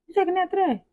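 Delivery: put-on high, soft mom checking on the kid; mother's questioning voice. Mother to her cranky three-year-old, asking about his nap. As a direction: ask it like a mom who already knows.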